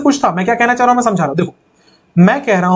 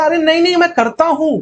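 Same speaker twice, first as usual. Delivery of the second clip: higher pitched नहीं, नहीं pushed insistently; emphatic insistence; 7 semitones higher than usual. Retold or imitated. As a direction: protest that you really do. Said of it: "मैं जोर देके बोलना चाह रहा था". (ऊँचे सुर में ज़ोर देकर नहीं नहीं)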